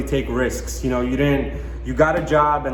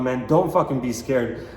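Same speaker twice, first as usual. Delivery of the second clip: ordinary talk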